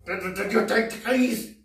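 imitated angry questioning, rising in pitch; angry grandmother. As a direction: confront a child like a fuming grandma. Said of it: "just angry as hell".

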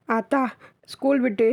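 put-on high, mildly sobbing child's voice; a boy telling his mother through light sobs. Boy tells mother of sister's kidnap, mildly sobbing. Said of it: "தேம்பி தேம்பி அழுது கொண்டே".

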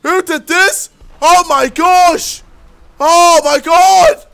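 loud, high, mounting alarm; shocked outcry. Real, not acted. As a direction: cry out in growing disbelief. Shocked by fire in game.

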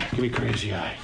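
ordinary delivery

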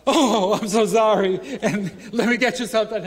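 high, loud, embarrassed gushing; mock grovelling apology. Tourist apologizing to the wrongly accused bag lady, gushing high mock voice. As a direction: gush out an apology, laughing. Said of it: "now I'm like".

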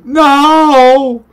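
high, drawn-out wails of no; hammed-up desperate cries; panicked pleading. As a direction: beg for your life, rising to a shriek. Begging as the game threatens.

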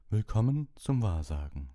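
mystic teacher. Acted. Trelawney greets the class.

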